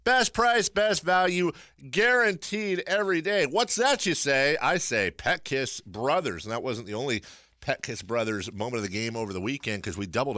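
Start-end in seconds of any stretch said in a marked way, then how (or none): 0.0-6.5 upbeat sponsor ad read. Acted. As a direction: sell it like a radio spot. Reads sponsor ad live.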